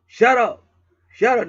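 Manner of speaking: loud, high repeated yell. Retold or imitated. Man fed up with barking dogs, yelling. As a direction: holler it at the dogs. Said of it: "yelling at 'em".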